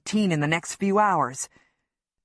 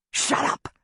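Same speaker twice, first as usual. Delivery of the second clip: urgent hiss. A narrator's reading. Hushing her in panic.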